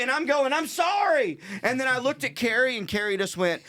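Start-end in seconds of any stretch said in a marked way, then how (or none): 0.5-1.4 high, pleading mock cry; frantic apology. Driver to the furious man, frantic acted apology. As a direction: plead over his cursing.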